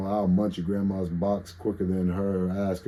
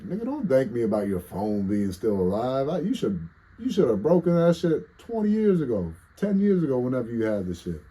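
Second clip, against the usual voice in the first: rising to a loud high scolding Jesus; lightly voiced Jesus; 6 semitones higher, 5 dB louder than usual. Streamer imagines Jesus scolding him over his phone, mock scolding. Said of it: "imagine Jesus like".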